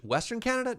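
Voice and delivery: high-pitched disbelieving question; incredulous echo. Real, not acted. Echoes chat's question in disbelief.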